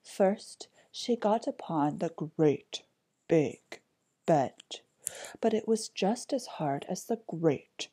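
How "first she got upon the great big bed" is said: ordinary delivery, nothing marked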